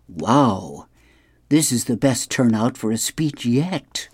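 lightly voiced comic-strip king; a touch of a marveling monarch. A narrator's reading. King looking down at the crowd, lightly acted royal voice. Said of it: "the king is gonna make an address".

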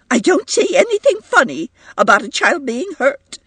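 high, upset and indignant; trembling voice. A narrator's reading. Mother to a laughing guest over her hurt child, trembling voice. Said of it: "she said in a trembling voice".

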